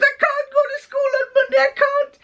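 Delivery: put-on high, whining teenage refusal through tears; tearful daughter. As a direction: beg not to go, voice breaking. Daughter refuses school, crying.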